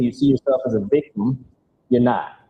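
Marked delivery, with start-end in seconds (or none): none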